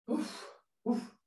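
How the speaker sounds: heavy sighs, then quiet fading bla; mournful speech. Shown as a demonstration. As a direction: deliver a eulogy with only bla. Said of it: "Ich mach nochmal die Trauer".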